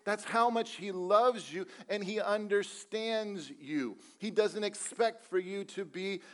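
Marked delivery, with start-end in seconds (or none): none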